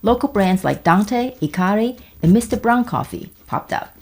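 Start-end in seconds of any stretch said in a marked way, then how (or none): none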